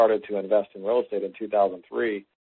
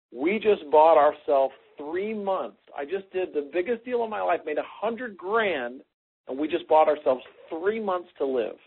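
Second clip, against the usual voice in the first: slow, higher, heavily weighted words; bitter retort; 7 semitones higher than usual. Retold or imitated. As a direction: spell out the grim news to the wife slowly and bitterly. Retelling his bitter answer to wife.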